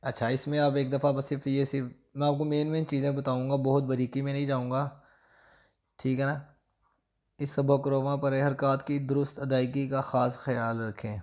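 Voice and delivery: usual speaking voice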